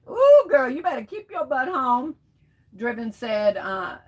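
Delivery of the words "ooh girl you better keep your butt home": laughing high and loud ooh. Real, not acted. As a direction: burst out laughing. Laughing at a chat joke.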